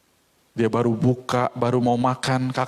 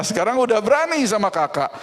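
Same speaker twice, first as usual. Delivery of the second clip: indignant sister; 7 semitones higher, faster than usual. Acted.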